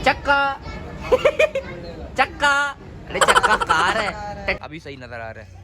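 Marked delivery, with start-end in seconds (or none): 0.4-2.1 high laughing burst; high, giggly laugh; burst of laughter. Real, not acted. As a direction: crack up laughing. Laughing at chakka.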